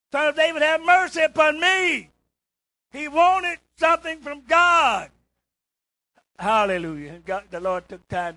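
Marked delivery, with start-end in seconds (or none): 0.1-5.1 loud, high, slow desperate cry; hollering for mercy. Retold or imitated. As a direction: holler it out like the blind man begging. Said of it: "He hollered the louder".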